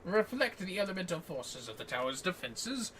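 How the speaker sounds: lightly voiced formal scholar, measured and courteous; NPC quest-giver voice. Streamer voicing the quest NPC to her co-op partner, lightly formal. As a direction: read it like a polite old-world sage.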